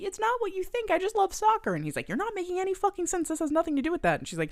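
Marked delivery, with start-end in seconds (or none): 0.0-1.7 pitched-up frantic excuse; high mock pleading. Viola pleads with her roommate, high and frantic. 2.1-4.2 pitched-up exasperated rant; high fast mock anger. Channing rants at Viola, high, fast mock anger.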